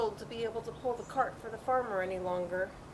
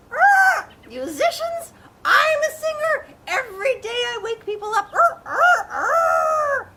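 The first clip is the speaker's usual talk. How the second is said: proud crowing rooster; 12 dB louder, slower than usual. Acted. Proud rooster claims to be singer.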